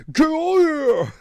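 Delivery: high loud mumbled yell; Sylvester Stallone impression. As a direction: bellow it like a hammy action hero. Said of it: "Sounds like Sylvester Stallone".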